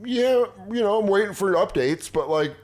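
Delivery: high-pitched, placating gamer voice; meek forum poster. Retold or imitated. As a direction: play the mild fan pleading the bright side.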